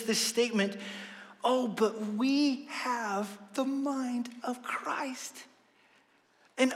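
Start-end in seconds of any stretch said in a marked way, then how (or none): 1.4-5.4 a slow whispered line. Retold or imitated. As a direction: breathe it out in awe, slowly. Quoting Paul's statement.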